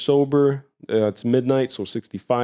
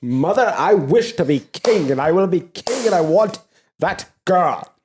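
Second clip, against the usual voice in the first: put-on high-pitched bratty boy-king; Joffrey; 7 semitones higher, 3 dB louder than usual. Spoiled king demanding things from mother, high whine. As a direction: demand it like a spoiled royal child. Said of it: "And Joffrey shall be crowned".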